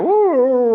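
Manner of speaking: high, loud mimicked dog whine; dog whining. Teller imitates the hound dog, high whining howl. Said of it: "just whining".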